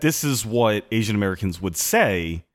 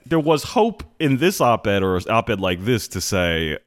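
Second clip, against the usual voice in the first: normal talking voice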